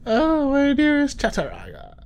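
high, loud mock lover's cry; hammed-up swooning. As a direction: swoon over her name theatrically.

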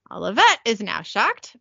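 ordinary talk, nothing marked

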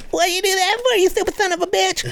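hammed-up wife yelling at her husband; mock angry lady. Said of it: "And then she goes".

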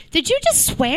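ordinary talk

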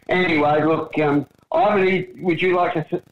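ordinary delivery

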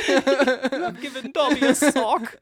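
slow, high, through real laughter; laughing. Real, not acted. Narrator derailed by a tongue slip, helpless laughing. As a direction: barely get it out while cracking up. Cracking up over misreading as Dobby.

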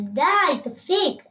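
high, loud scolding shout; shouting at the cat. Acted. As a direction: yell at the cat to stop. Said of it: "צעקתי לחתול". (צעקה נוזפת על החתול)

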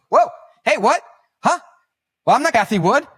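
loud, high mock-startled objection; startled retort. Guest corrects the host's introduction, startled. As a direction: imitate a guest taken aback, pitch shooting up.

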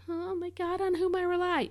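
high, thin, frantic wail; a high panicked moan of no faith; panicky whine. Shown as a demonstration. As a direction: fake a desperate, doubtful cry. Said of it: "we don't wanna say that with".

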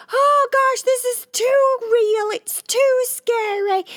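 mock fright. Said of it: "you're like".

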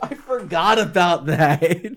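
slow words through laughter; laughing. Real, not acted. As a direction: talk while cracking up. Laughing after tornado joke.

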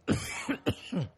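real throat-tickle cough; tickly cough. Real, not acted. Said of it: "got a tickle".

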